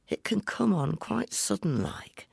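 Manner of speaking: secretive, high whisper; confiding whisper. Acted. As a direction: lean in and whisper it. Old woman confiding secret.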